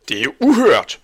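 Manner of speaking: high, loud indignant protest; outraged. A narrator's reading. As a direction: exclaim it in outrage. Angry butcher protests to Bastian. (højt og forarget)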